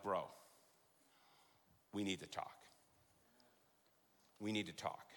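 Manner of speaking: low, hushed, grave "we need to talk"; mock-ominous quiet warning; hushed serious. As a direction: drop the voice like bad news is coming. Mock serious talk, retold quote.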